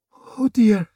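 high, scared "Oh dear"; whimpering dismay; frightened. Acted. Frightened prisoner sees Regent.

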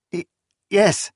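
frightened stammered yes; stammering. A narrator's reading. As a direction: stumble over the word. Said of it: "she stammered".

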